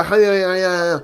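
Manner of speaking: loud, drawn-out mock commentary call; mock Spanish commentator. Imagining Spanish commentators naming English clubs, loud mock call. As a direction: build up to a big commentator's shout. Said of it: "You know, they don't go".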